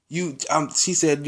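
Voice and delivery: usual speaking voice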